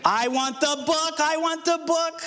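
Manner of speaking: put-on high whiny child's demand; angry child. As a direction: whine like a stubborn toddler.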